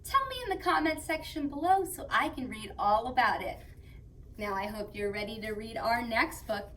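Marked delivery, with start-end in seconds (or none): none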